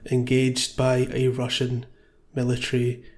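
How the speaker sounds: ordinary delivery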